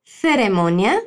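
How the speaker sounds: "Ceremonia" with the first sound as th, tongue between the teeth; "Ceremonia" th like Spain; Castilian theta. Shown as a demonstration. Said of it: "Habla nuevamente".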